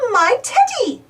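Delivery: high delighted exclaim; bright little shout of discovery; excited cry. Acted. Peppa realising teddy is in every photo, excited cry. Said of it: "cries Peppa".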